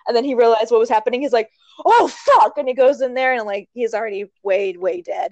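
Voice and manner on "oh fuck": high, loud exclamation of dismay; retold alarmed realization. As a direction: act out his sudden shocked discovery. Retold moment of horrified realization.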